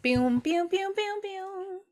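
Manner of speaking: rising hummed synth notes; imitated theme music. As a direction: mimic the slow-motion film theme. Imitates Chariots of Fire theme.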